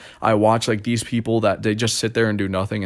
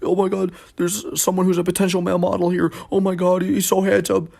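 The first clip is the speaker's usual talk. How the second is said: high, fast mock gushing; excited old lady; 9 semitones higher than usual. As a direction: call everyone over in excitement. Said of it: "calling all these people".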